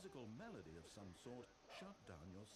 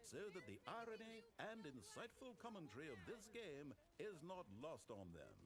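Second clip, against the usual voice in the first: higher voice hailing the room; calling out; 9 semitones higher than usual. Acted. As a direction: call out to whoever is nearby. Calling out to anyone nearby.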